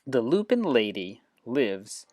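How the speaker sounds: clear, measured picture-book reading; gentle read-aloud for a class. Shown as a demonstration. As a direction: read it like story time for children. Reads picture book aloud to app.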